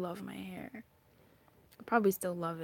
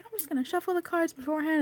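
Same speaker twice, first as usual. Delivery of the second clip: fast, high, loud self-imitation; breezy self-quote; 8 semitones higher, 5 dB louder, faster than usual. Reader quoting own plan to shuffle, high and hurried. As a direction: rattle it off high and quick. Quoting herself planning before cards object.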